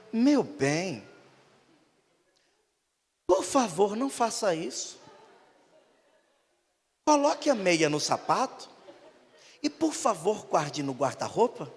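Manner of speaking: slow, overly polite wife; a hint of a prim, gentle housewife; lightly voiced refined wife. Wife politely scolding her husband about his shoes, slow. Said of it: "imagino aquela mulher dizendo".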